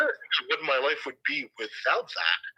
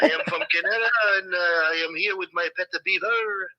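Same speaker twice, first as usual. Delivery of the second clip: hammed-up French accent, higher; phony French accent; 5 semitones higher than usual. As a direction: put on a fake French accent. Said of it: "my phony French accent".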